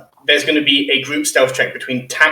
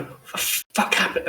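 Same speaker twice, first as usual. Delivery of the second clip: half-whispered, acted reaction; hushed exclamation; 6 semitones higher, 8 dB quieter than usual. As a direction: mutter it in a hushed voice. Character reacts behind the door.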